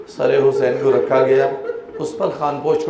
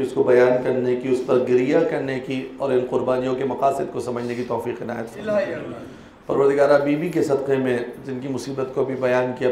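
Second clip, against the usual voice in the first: prayer; 3 semitones lower, 5 dB quieter than usual. Acted. Preacher leading the mourners in closing dua, soft. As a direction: lower and softer supplication.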